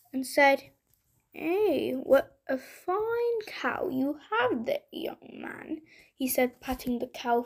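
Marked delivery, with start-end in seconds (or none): 1.3-5.8 slow, hearty tradesman; friendly butcher voice. Acted. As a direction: greet him like a jolly butcher. Butcher greets Jack.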